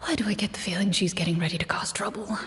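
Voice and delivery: hushed whisper under the breath; whispered aside. Acted. Game master suspects she's about to cause trouble, whispered aside. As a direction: whisper it so the players don't hear.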